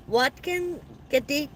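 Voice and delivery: slow, careful try at "Watkin Gedi", rising at the end; unsure name reading. Real, not acted. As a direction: sound out "Watkin Gedi" hesitantly, like a question. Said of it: "I don't wanna ruin your name".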